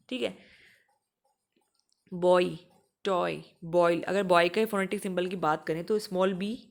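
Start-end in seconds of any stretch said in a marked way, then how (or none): 2.1-4.0 बॉय, टॉय, बॉयल repeated loudly with a clear o-ee glide; louder word-by-word demo of बॉय, टॉय, बॉयल; 'oi' diphthong demo. Shown as a demonstration. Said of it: "ये बॉय ही होता है".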